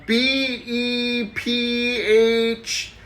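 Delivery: each letter drawn out slowly; slow spelling. Acted. Susie spells the word for the teacher, very slowly.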